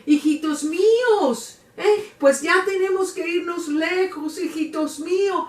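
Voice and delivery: put-on high-pitched calling out to her children; acted wailing cry. La Llorona calls to her children at night, crying out. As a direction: cry out like a grieving mother in the night. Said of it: "iba gritando por la noche, andaba dando gritos".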